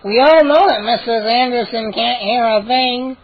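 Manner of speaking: smug little gnome jeering; grinning gnome. A narrator's reading. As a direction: taunt them with a grin. Gnome Hap taunting.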